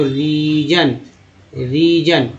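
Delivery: रीजन slowly, with a stretched री; syllable-by-syllable demo. Shown as a demonstration. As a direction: draw out री, then add जन in रीजन. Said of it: "जन बोलते हैं". (रीजन में री खींचकर, फिर जन)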